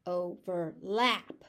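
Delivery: „overlap“ slowly, stress on LAP; slow syllable demo. Shown as a demonstration. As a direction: stretch out overLAP. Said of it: "As a verb".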